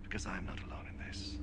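soft unvoiced whisper. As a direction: drop to a whisper. Jesus answering the Pharisees.

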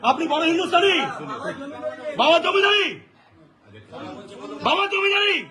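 real confrontational jeer; angry taunt. Real, not acted. Heated confrontation with railway clerk.

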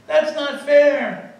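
howling protest. Retold or imitated. Students protesting their F, high slow howl. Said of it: "The students howled in protest".

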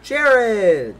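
shouting a name in surprise; loud shout. Real, not acted. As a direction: yell the name out. Spots Jared driving the taxi.

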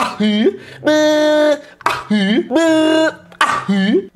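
lightly voiced cartoon squeak, ahi bê; playful put-on cartoon sounds; silly imitation. Vlogger goofing around with a mild cartoon voice. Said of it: "Eu tô muito imitador hoje".

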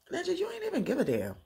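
very high, fast and heated; mock furious daughter, high and quick; indignant outburst. Retold outburst at father.

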